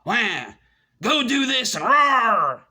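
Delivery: hammed-up tantrum, rising to a high, drawn-out roar; mock petulant teenage girl. Mocking the alphas as petulant girls, mock tantrum. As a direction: wail and roar like a petulant teen. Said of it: "You sound like a petulant teenage little girl".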